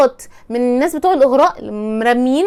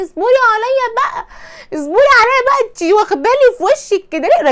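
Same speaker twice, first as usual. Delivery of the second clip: playful exasperation; 9 semitones higher, 4 dB louder than usual. Real, not acted. Teasing impatient student.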